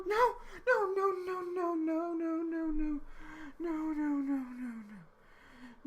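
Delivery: high, crying no's, voice breaking; real tears over a lost game character. Real, not acted. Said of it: "I'm crying".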